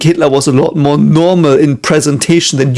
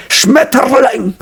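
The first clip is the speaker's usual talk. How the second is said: high, drawn-out harsh parody "Schmetterling"; Hitler; 9 semitones higher, slower than usual. Retold or imitated. Testing viewers' opinion, hammed-up Hitler-style "Schmetterling". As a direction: stretch "Schmetterling" into a mock dictator's rant. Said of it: "So the, the Hitler version".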